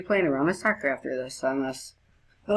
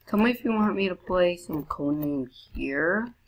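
ordinary talk, nothing marked

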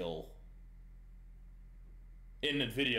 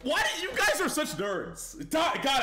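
high, loud laughing exclamation; 5 dB louder than usual. Real, not acted. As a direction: laugh at the chat while talking. Laughing at chat's nerdiness.

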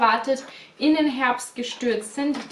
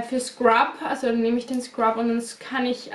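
ordinary talk

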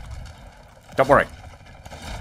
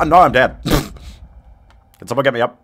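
ordinary talk, nothing marked

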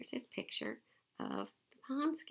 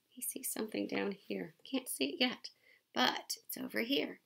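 usual speaking voice

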